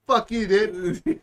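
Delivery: slow, laughing jab; laughing curse. Real, not acted. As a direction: laugh through the insult. Laughing at friend.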